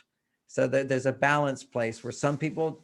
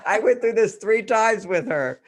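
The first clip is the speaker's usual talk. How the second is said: laughing; 6 semitones higher, 6 dB louder than usual. Real, not acted. Laughing retelling car saleswoman story.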